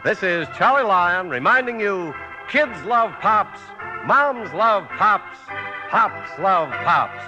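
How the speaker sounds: ordinary talk, nothing marked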